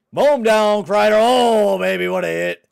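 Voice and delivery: very loud, high cheering all the way through; hype shouting. Real, not acted. Gamer screaming at a huge hit in his game, hype shout. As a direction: scream your player on like a fan.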